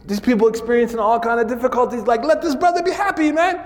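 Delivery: high, quick plea; sheikh pleading. Retold or imitated. Sheikh asking the critic to let the brother be happy, fast and high. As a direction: urge them, fast and high.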